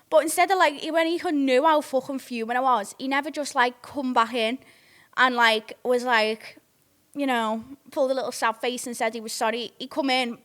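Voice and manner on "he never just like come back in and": ordinary delivery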